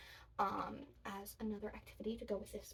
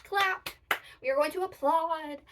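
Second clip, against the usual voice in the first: loud cheer; 4 semitones higher, 11 dB louder than usual. Acted. Cheering children to clap.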